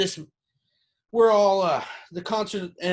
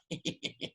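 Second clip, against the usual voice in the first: quiet wheezy villain snicker; psychotic little laugh; 12 dB quieter than usual. Retold or imitated. Boss laughing after his kiddie train line, quietly psychotic. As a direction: imitate a creepy, psychotic chuckle. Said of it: "he did a little psychotic laugh".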